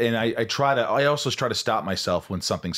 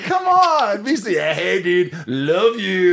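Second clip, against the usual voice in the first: much higher, louder pep talk to the mirror; loud, high bro-style hype; hyped self-pep; 10 semitones higher, 7 dB louder, slower than usual. Retold or imitated. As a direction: pump yourself up like a bro. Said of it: "I'm like".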